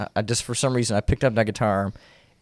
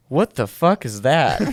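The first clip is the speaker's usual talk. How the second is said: gruff dad; 3 semitones higher, slower than usual. Retold or imitated. Dad reacting to the broken guitar.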